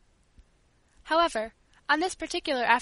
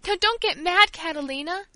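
high, loud pleading cry; bursting into tears, higher and louder; tearful plea; 6 semitones higher, 6 dB louder than usual. Acted. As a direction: beg through tears. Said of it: "I cried, as I burst into tears".

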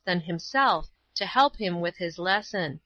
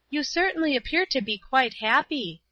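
put-on higher-pitched voice of a young girl; Paula; 6 semitones higher than usual. A narrator's reading. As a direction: lift the voice high for a girl's line.

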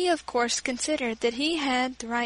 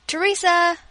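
loud call across the garden; 6 semitones higher, 9 dB louder than usual. Acted. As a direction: call her name out loud and high. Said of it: "called my father".

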